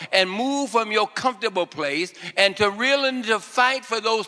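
fervent prophetic utterance; prophetic preaching. Acted. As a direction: speak as the Lord over them. Prophetic word spoken as God.

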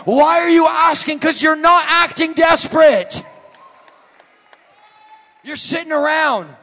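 loud, high preacher's shout; preaching at full force. Acted. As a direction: lift the room with every line. Preacher climbs back to shout.

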